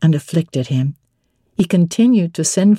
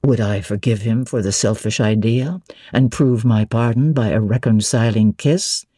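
a lower, pleading man's voice for his longing; lightly voiced Rochester; 7 semitones lower than usual. A narrator's reading. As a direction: drop the voice a little for the ardent suitor.

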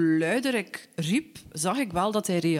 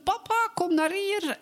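high, urgent calling voice. Retold or imitated. Daughter guides her blind father by voice, high urgent call. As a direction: call your swimming father toward you. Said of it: "Dus ik bleef maar roepen".

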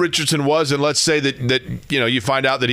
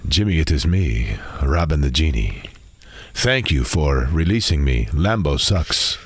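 low, made-up genie voice; 5 semitones lower than usual. Acted. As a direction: speak low as a granting genie. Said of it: "I completely made up the genie voice".

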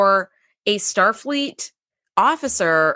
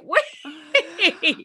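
laughing wait; 7 semitones higher than usual. Retold or imitated. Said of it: "I was like".

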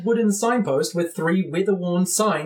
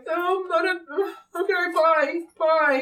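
put-on nervous young wizard; 10 semitones higher, slower than usual. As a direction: back away meekly in a high voice.

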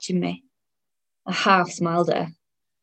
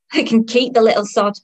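loud griping; 4 semitones higher, 6 dB louder than usual. Acted. Fed-up mother complains about child.

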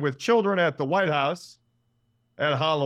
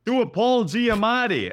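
higher, louder call-out; mock yell from crowd; 8 semitones higher, 5 dB louder, slower than usual. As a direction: shout a request from the street. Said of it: "another person yells out".

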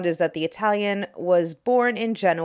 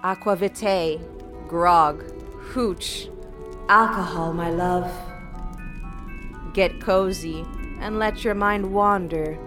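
sultry intro read. Acted. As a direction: purr it like a naughty invitation. Seductive podcast intro over music.

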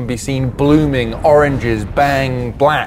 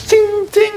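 very high vocal blade pings; high-pitched imitated metal ting; rapier clinking imitation. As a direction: mimic tiny sword strikes. Imitating rapier striking tentacle.

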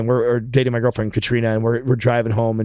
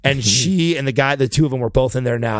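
ordinary talk

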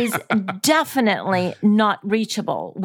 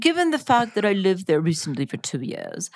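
put-on English accent; 4 dB quieter than usual. Co-host who lived in Britain, keeping up English accent. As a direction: draw out the lofty British accent. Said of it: "her version of an English accent".

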